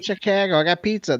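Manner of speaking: mock Boston accent. Ribbing Chris about Chicago, mild mock Boston accent. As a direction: keep mocking it in a half-hearted Boston accent. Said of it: "You're still doing your Boston accent".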